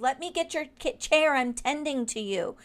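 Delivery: insistent offer. Retold or imitated. To a guest who refused help, an insistent loud offer. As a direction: push past her refusal, raising the voice on chair. Insisting on fetching her chair.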